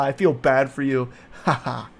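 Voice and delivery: ordinary talk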